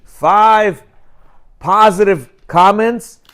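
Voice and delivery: ordinary delivery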